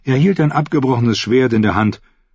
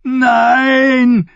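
high, loud terrified shriek; 5 dB louder than usual. A narrator's reading. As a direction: scream in terror. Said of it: "kreischte Dick". (kreischend vor Angst)

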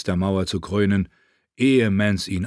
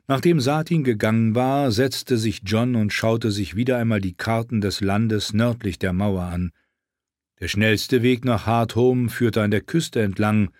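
ordinary delivery

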